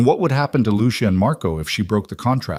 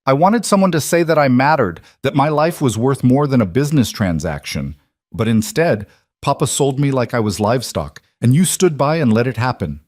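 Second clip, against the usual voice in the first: higher and louder, words ripped out; anguished outburst; 5 semitones higher, 3 dB louder than usual. Acted. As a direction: hurl the hurt at her mother. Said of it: "The words tore out of Isabella's throat".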